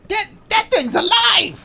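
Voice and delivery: put-on slow, high, loud kid voice; spooked child warning loudly; Nate. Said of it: "Nate looks at you and says".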